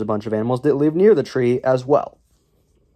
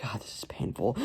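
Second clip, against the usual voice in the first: softer, weary whisper under the breath; whispered groan; 3 semitones lower, 14 dB quieter than usual. Real, not acted. Reviewer pained by the movie plot, weary whisper. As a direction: mutter it to yourself in despair.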